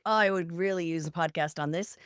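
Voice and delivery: usual speaking voice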